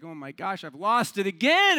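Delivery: exasperated with yourself; acted self-reproach. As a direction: catch yourself, then wail it in mock dismay. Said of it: "All of a sudden, he's like".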